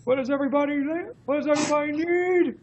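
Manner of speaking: very high imitation scream; Al Snow's scream. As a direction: call it out high to an imagined crowd. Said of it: "Al Snow would scream".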